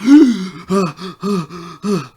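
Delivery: loud, high sharp gasp of shock; deep gasp. Acted. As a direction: gasp hard as if seeing a ghost. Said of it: "he takes in a deep, uh, uh, gasp".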